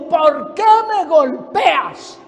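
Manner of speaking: slow, drawn-out question from the donkey; donkey's reproach. Acted. Donkey to the prophet who beats him, slow reproach. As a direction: stretch out each word in reproach.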